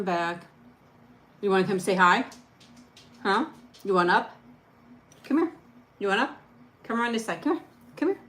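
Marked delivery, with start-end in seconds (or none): none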